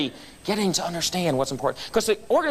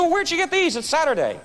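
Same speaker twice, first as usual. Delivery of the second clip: surprised; 10 semitones higher than usual. Retold or imitated. Customer surprised at the shoes.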